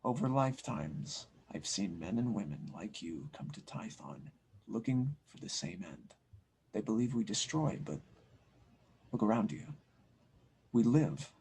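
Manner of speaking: low, soft, unhurried sage; soft, wise mythic voice. Acted. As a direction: speak like an ancient monk who has seen it all. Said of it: "the soft, very wise, and almost mythic voice".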